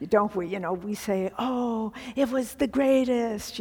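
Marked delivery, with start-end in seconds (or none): 1.4-3.6 higher, lively mock gushing; gushing superlatives. Teacher mimicking how we talk in superlatives, gushing. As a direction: act out everyday gushing over something. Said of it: "we speak in superlatives".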